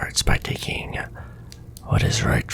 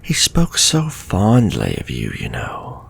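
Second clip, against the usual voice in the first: normal talking voice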